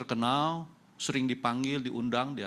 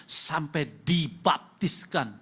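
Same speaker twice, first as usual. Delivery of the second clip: ordinary talk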